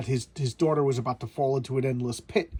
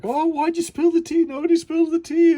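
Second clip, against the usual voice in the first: much higher and louder, a prissy demon's outrage; shrill demon voice; 8 dB louder than usual. Retold or imitated. Prissy demon scolds the girl for spilling tea, high and loud. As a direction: squeal it like an offended fussy demon.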